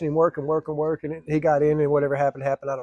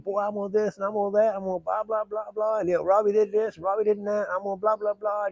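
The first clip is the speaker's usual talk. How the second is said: a hint of a boastful politician, pitched up; mock politicking; 6 semitones higher than usual. Candidate brags to workers, lightly mocked politician voice. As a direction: rattle off campaign claims. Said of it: "he just really politicking".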